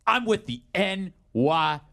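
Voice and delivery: slow, drawn-out movie-cop swagger; mock badass cop, very slow; tough cop line. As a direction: lay it down like a hammy action hero. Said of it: "he goes".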